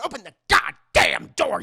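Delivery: mock enraged boyfriend; angry yell. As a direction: bang on the door yelling. Prank turns hostile in retold scene.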